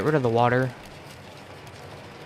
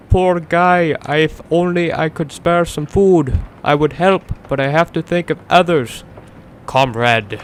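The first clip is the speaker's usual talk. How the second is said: put-on loud, higher, overblown Russian accent; hammed-up Russian voice; 4 semitones higher, 6 dB louder than usual. Voicing a survivor's line, loud and hammed-up Russian. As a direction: belt it out as a cartoon Russian comrade. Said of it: "can't think of any Russian voice".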